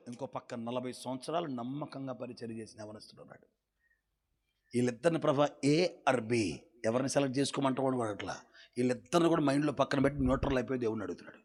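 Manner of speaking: ordinary talk, nothing marked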